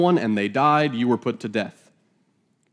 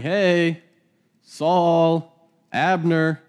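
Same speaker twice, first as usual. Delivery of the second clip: higher, calling across a distance; 5 semitones higher, much slower than usual. Acted. David hollering over to Abner from the hill, calling out. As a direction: hail them from the far hilltop. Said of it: "he's calling out and saying".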